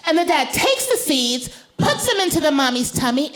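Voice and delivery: put-on high, careful child's voice; lightly voiced high little girl; 1 serious little girl. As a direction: spell it out like a thoughtful toddler. Daughter voiced.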